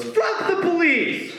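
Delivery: high, slow yelled chant; high rebellious kid shouting at the teacher; mock shout. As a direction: yell it like a defiant kid from the back. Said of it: "Isabel goes".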